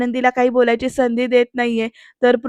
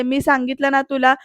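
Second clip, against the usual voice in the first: usual speaking voice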